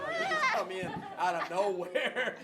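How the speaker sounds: high, loud, laughing through the words; cracking up while teasing the ladies. Real, not acted. Pastor teasing the wives in the room, laughing loudly.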